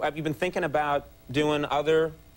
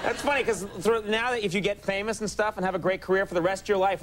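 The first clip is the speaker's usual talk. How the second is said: ordinary delivery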